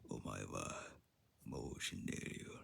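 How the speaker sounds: deep, dramatic anime villain line. Shown as a demonstration. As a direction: drop low and menacing, anime style. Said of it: "I could do an anime voice".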